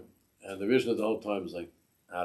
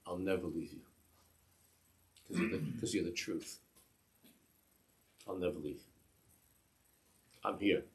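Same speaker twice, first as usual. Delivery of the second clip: soft, halting declaration with long pauses; retold tearful vow; 4 dB quieter than usual. Badchan vowing to the rebbe he'll stay, softly tearful. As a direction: say it softly, pausing between phrases. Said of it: "he was crying like a baby".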